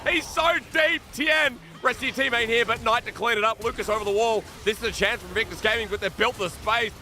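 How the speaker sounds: loud, high-pitched esports shoutcasting; urgent, shouted live commentary; excited play-by-play. Real, not acted. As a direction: call the team fight at full force. Live play-by-play of team fight.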